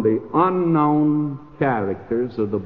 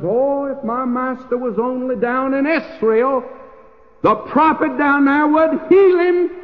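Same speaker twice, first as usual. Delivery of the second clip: put-on high, rising, louder eager girl's voice; servant girl's eager plea; 11 semitones higher, 4 dB louder than usual. Servant maid urging the wife, high eager plea. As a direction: lift into a bright, pleading servant girl.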